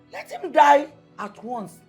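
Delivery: high, loud, drawn-out demand; angry outburst. Acted. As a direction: throw it out loudly at the daughter. Mother quarrels with daughter.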